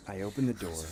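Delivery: ordinary delivery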